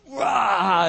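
loud, high mock battle cry; imitated little boy's warrior roar; sudden full-throated "rah" as you strike; attack yell. Child attacks spider with shoe.

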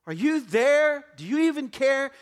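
loud, high, desperate plea to God; panicked cry. Acted. Frightened disciples crying out in the storm, loud and high.

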